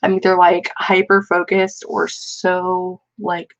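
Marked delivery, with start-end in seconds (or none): none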